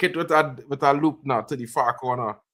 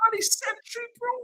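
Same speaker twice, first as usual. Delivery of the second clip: excited disbelief; 6 dB quieter, faster than usual. Real, not acted. Watcher amazed at the substitute's introduction, excited. Still reacting to the near goal.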